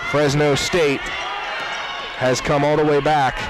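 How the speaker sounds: usual speaking voice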